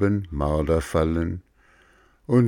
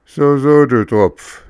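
loud, higher furious scolding; angry; 7 semitones higher, 9 dB louder than usual. Acted. Farmer catches the puppet in his trap, loud and furious. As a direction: spit the words out in rage. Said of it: "sagte der Bauer wütend".

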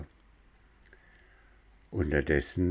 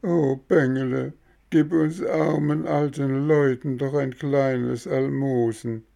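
high, whining beggar's plea; sly old fox begging, voice pitched high; howling voice; 6 semitones higher, 3 dB louder than usual. Acted. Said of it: "mit heulender Stimme".

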